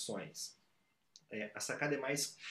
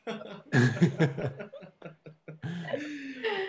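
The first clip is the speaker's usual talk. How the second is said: big hearty laugh, loud and long; loud burst of laughter; 6 semitones higher, 9 dB louder than usual. Real, not acted. Laughs at the sneeze joke.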